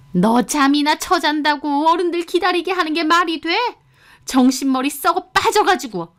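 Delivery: high, vicious scolding; ranting, yelling mother-in-law. A narrator's reading. Mother-in-law blames daughter-in-law for keeping elders waiting, high angry rant. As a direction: rail at her in a high, outraged voice. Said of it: "저한테 악다구니를 쓰며 소리를 지르시는데".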